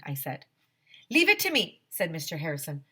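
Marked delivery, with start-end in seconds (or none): 1.1-1.8 high, loud boastful voice; boastful handyman voice; confident handyman. Acted. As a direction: brag it up high. Mr Harrison voiced in story.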